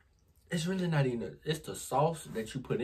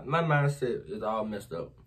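usual speaking voice

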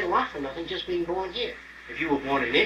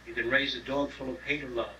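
ordinary talk

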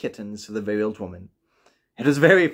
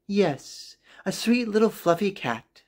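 put-on higher, sweet old woman voice; old woman, pleading; 6 semitones higher than usual. Old woman asking her husband for a fluffy cat, higher and sweet. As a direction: coax it gently as the old woman.

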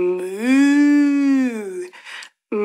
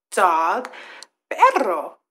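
normal talking voice

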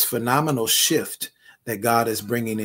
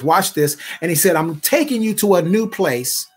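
high, loud, ringing sermon climb; fervent pulpit preaching; full-force preaching; 4 semitones higher, 4 dB louder than usual. Acted. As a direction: drive the scripture home to the bishop. Preaching Abram's call.

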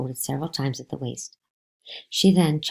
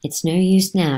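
triumphant; 4 semitones higher, 8 dB louder than usual. Acted. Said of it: "grinning triumphantly".

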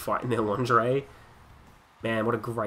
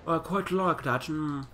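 higher, slow mock-aristocratic English; snobby English aristocrat; 6 semitones higher, slower than usual. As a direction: savour each word like an English snob. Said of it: "They're not all like".

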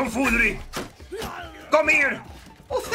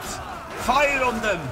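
ordinary delivery

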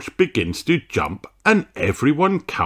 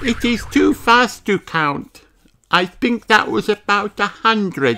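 high little sheep voice, chatty; Susie's voice; 12 semitones higher than usual. A narrator's reading. Susie to her friends, high and amazed. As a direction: voice Susie, high and bright-eyed. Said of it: "says Susie".

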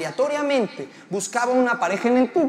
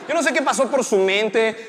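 ordinary talk

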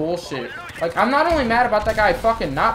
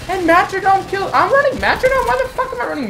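outraged disbelief; 6 dB louder than usual. Real, not acted. Gamer finding the wrong perk on his class, outraged disbelief. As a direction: voice leaps up very high and loud. Shocked at own loadout.

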